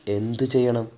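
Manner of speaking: softly, with a touch of dread; hushed dread. A narrator's reading. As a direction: ask it quietly, mildly fearful. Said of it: "ഉൾക്കിടലത്തോടെ പതുക്കെ ചോദിച്ചു". (ഉൾക്കിടലത്തോടെ പതുക്കെ)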